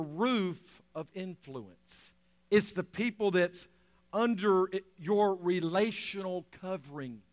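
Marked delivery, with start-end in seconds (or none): none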